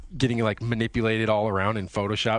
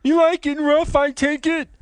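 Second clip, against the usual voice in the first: very high, loud put-on voice; hammed-up high-pitched come-on; game master role; 7 dB louder than usual. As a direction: play the flirt in a squeaky mock voice. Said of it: "I could be the G M".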